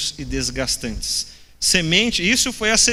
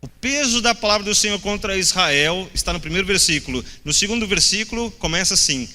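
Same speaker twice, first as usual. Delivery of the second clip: ordinary talk, nothing marked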